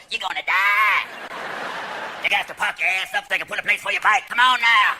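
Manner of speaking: put-on loud Louisiana psychic accent; hammed-up Louisiana fortune-teller accent. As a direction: pronounce doom like a Louisiana psychic. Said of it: "like those Louisiana psychics go".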